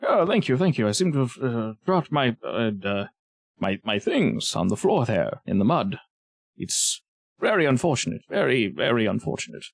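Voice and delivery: put-on hesitant old fellow, fumbling for words; doddery old man. Old man dropped his things in mud, doddery voice. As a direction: dither gratefully like a frail old man.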